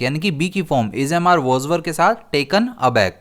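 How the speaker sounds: ordinary talk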